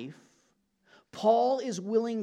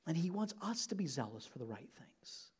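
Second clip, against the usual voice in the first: much softer, turning it on the listeners; quiet personal appeal; 3 semitones lower, 10 dB quieter than usual. Shown as a demonstration. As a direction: drop the voice and make it personal. Applies it to listeners.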